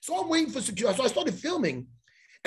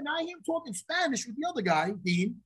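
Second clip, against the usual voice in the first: ordinary delivery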